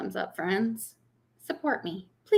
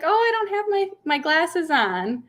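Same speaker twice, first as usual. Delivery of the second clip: put-on old lady neighbor; 9 semitones higher than usual. Old neighbor excuses her mistake, high imitated voice. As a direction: explain as the elderly neighbor, pitched way up. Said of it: "And she's like".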